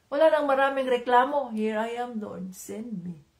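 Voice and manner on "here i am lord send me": much softer and slow, "send me" almost breathed; soft prayer. Shown as a demonstration. Preacher echoes Samuel's answer to God as a soft, slow prayer. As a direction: whisper it like a humble prayer. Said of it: "Ganun kasimple".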